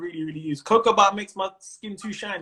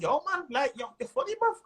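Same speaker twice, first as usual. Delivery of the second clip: put-on high, excited Mancunian accent; Manchester accent; 10 semitones higher, 3 dB louder than usual. Manchester crowd hailing the comedian, excited local accent. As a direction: put on a keen Manchester fan, pitched way up. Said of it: "I hear the accent".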